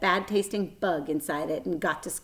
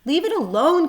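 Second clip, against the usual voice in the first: higher, louder command; 9 semitones higher, 7 dB louder than usual. A narrator's reading. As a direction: order him firmly. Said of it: "commanded Fern".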